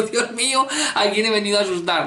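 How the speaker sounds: a hint of a shocked little dog; imagined dog's voice. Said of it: "se fue diciendo".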